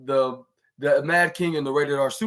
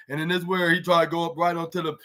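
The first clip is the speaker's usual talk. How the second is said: ordinary talk, nothing marked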